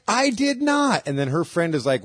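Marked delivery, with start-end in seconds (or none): 0.1-1.0 high squeaky denial; indignant guy. Retold or imitated. Accused guy denying it, high squeaky voice.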